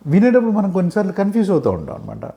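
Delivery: ordinary talk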